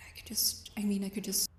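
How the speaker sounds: hushed nervous self-talk; barely voiced, trailing off; whispering. Whispering to herself.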